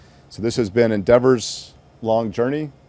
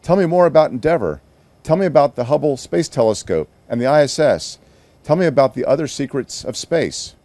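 put-on higher and fast, eager child's plea; excited pleading; 6 semitones higher, 6 dB louder than usual. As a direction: beg Mom for more, bursting with eagerness. Excited child begging mom.

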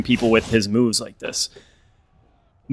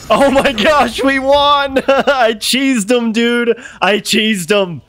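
shrieking jackpot winner; 11 semitones higher, much slower than usual. Real, not acted. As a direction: shriek with disbelieving joy. Winning the match.

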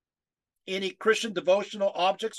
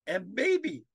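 choked up. Real, not acted. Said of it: "sorry, choked me".

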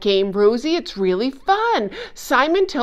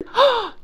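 excited gasp; 12 semitones higher than usual. Acted. Puppet invited to learn words.

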